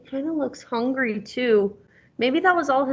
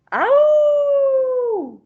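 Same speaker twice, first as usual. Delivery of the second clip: long, much higher, louder wolf howl; 9 dB louder than usual. Acted. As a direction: throw your head back and howl like the wolf. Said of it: "leaped at the animals with a howl".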